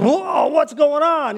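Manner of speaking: high, slow, dazed confusion; just woken and totally lost, voice lifted high; bewildered whoa. Retold or imitated. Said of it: "These guys wake up like".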